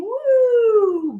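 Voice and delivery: high, swooping woo; bouncing whoop. Acted. Bear flies off the trampoline, high swooping whoop. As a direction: whoop up and slide down.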